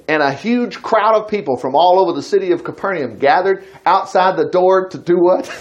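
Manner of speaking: usual speaking voice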